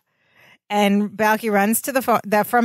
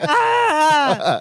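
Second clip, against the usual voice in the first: higher-pitched imitated wail of agony; mock agonized scream; 5 semitones higher than usual. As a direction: wail in pretend anguish. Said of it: "scream in agony".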